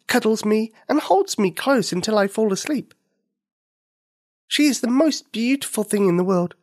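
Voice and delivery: put-on high, loving little girl; a child listing her mother's care. Nikini tells the rabbit.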